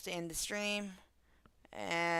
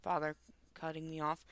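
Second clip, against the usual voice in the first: ordinary talk, nothing marked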